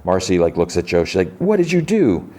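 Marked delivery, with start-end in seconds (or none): 1.4-2.3 high, dramatic imitated question; mock horrified outcry. Marcy looks at Joe after the zap, high mock outcry. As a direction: act out shocked accusation, pitched way up.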